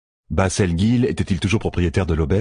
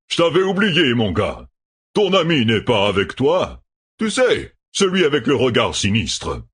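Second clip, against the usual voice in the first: jovial innkeeper; 5 semitones higher than usual. Acted. Gill l'aubergiste parle.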